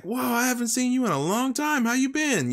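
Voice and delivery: high-pitched mock surprise; surprised villager greeting. Villager greeting a long-absent player, high mock surprise. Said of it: "they'll be like".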